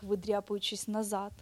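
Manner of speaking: plain reading voice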